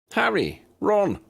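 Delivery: bright, high, loud call of welcome; delighted. A narrator's reading. Seamus spotting old school friends, delighted call. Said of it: "came a delighted voice".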